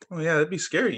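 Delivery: ordinary delivery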